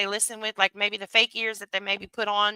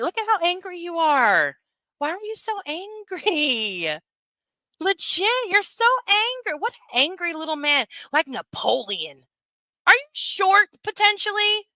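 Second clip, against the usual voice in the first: taunting; 9 semitones higher than usual. Real, not acted. Taunting an angry commenter.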